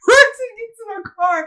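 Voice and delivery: high, slowed by real laughter; laughing through words. Real, not acted. Cracking up telling how she fled, laughing through it. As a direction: struggle to talk while cracking up.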